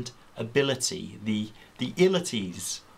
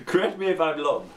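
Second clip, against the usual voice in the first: ruler voice; 5 semitones higher, 4 dB louder than usual. Acted. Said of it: "said the ruler to the piece of string".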